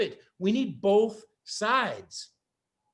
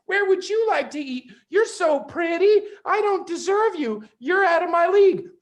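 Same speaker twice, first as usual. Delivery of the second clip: high simpering voice; mocking a fawning boyfriend, higher; mock grovelling man; 10 semitones higher, 5 dB louder than usual. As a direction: simper like a doormat, pitched way up. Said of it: "if you're being like".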